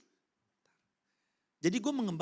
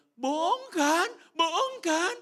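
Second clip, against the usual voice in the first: put-on much higher, louder pleading child; little child voice; 7 dB louder, much slower than usual. Small boy begging his parents it's a joke, child voice. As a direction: beg like a scared little kid.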